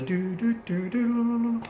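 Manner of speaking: high electronic loading tones; imitated game sound. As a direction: mimic an old computer tape's tones. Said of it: "you'll see that sound".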